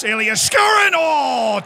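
high, loud yell, then a groan of near-miss; excited shout. Real, not acted. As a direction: cheer the shot, then deflate.

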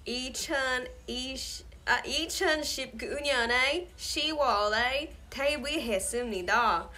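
Korean with a broad American Southern twang; Southern-accented Korean. Shown as a demonstration. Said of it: "Korean in a southern accent".

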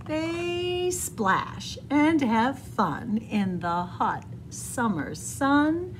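usual speaking voice

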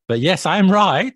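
loud, high laughing gloat; gleeful laughing boast; laughing triumph. Real, not acted. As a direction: crow it through a laugh. Triumphant laugh after checking.

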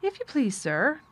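high-pitched, deferential announcement; announcing maid. A narrator's reading. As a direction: announce visitors to the young master. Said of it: "announced Martha".

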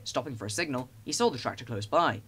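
usual speaking voice

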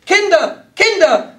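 high, loud alarmed calls; frightened mother shouting; anxious cry. A narrator's reading. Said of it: "schrie Baronin von Butler ängstlich".

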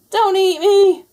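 high, loud frantic plea; desperate plea. Acted. Garfield begs the ghost not to eat him, high and loud. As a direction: beg for your life. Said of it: "pleaded Garfield".

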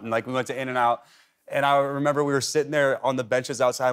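usual speaking voice

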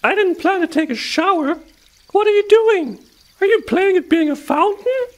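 very high, indignant little mouse voice; surprised mouse. A narrator's reading. Splashed field mouse questions the hedgehog, surprised. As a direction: squeak it out, high and loud. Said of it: "called out a surprised mouse".

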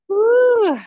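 loud high-pitched ooh of effort. Real, not acted. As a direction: let out a big surprised ooh as the stretch bites. Strong stretch in class.

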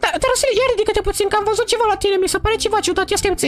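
put-on high falsetto girl voice; high put-on girl voice scolding her old friend. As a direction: play the offended girl in a high voice. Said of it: "trebuie să fac vocea".

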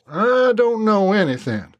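lightly drawled, defensive Brent; Brent, evasive Southern drawl. A narrator's reading. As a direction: shrug it off like a cornered Southern man. Brent denies knowledge.

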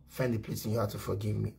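hushed and pleading; begged quietly to Vera; soft plea. Real, not acted.

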